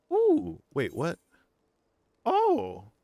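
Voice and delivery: high surprised squeal. Real, not acted. Sudden surprise in game.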